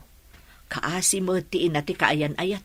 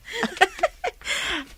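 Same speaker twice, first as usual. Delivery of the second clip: a bright, high-pitched burst of laughter. Acted. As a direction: laugh up high at the daughter's teasing. Mother teases daughter, laughs.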